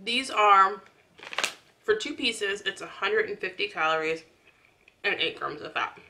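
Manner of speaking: talking with food in mouth; eating between words; chewing while talking. Real, not acted. Seen 0: Holds snack at her mouth, biting and chewing between phrases.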